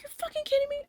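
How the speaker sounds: squeaky high put-on disbelief; mocking angry boss. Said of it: "And he was pissed".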